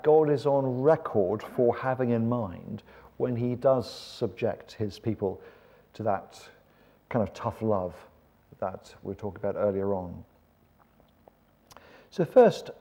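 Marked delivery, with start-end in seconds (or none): none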